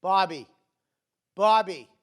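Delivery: loud calling of a name, each falling; mother calling out. Retold or imitated. A mother calling her son from downstairs, loud. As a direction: call the kid down from downstairs. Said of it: "she'd go".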